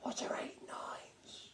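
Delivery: soft unvoiced whisper behind a hand; hushed schoolboy whisper. A narrator's reading. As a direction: breathe it out secretly, slowly. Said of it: "whispered very softly".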